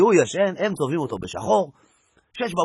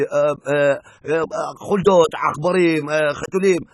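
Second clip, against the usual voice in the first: halting, slow answer with ums; hesitant Bedouin; 4 dB louder, slower than usual. Acted. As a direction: stall and search for words.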